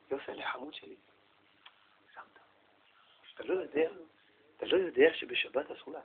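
very soft, hushed coaxing voice; gentle father. Acted. Father gently corrects child who switched on a light, tenderly. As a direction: correct the child tenderly, barely above a murmur. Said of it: "ומדבר בעדינות".

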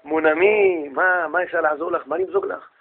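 hushed, over-courteous host; suddenly polite husband. Acted.

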